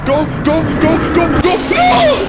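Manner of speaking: high, loud terrified shrieks; panicked screaming. Real, not acted. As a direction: scream as he catches you. Caught by the chaser.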